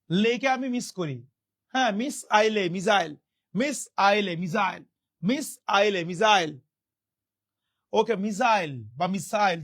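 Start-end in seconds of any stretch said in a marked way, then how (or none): none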